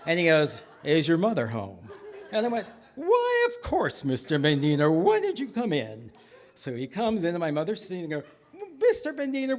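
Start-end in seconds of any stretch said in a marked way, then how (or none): 0.8-1.9 low, ominous visitor voice; stern rabbi. Acted. Feared rabbi questioning child at the door, low menace. 2.9-6.1 feigned innocence. Acted. Child covering up to the rabbi, fake-innocent welcome. 8.8-9.6 high hostess voice; polite mother. Acted. Mother receiving the feared rabbi, high polite voice.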